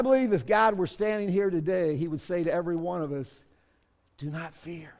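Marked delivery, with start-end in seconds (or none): 4.2-4.9 gentle reassurance. Pretend. God speaking gently to everyone.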